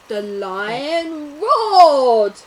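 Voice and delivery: slow, loud roaring line. A narrator's reading. Freed lion roars loud and slow. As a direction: roar it big and slow.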